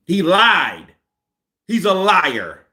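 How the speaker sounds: loud, heated accusation. Real, not acted. Vlogger ranting at Fury, loud and heated. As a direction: hammer it home angrily.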